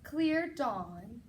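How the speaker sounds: plain reading voice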